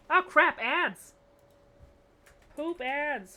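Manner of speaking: loud, slow, high cry of alarm; startled outburst. Real, not acted. Streamer spotting ads on stream, loud alarmed cry. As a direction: blurt it out in sudden dismay.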